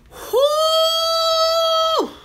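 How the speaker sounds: long high-pitched whoo shout; exasperated whooping cry. Real, not acted. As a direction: let out a high wailing whoop. Remembering Denny's days.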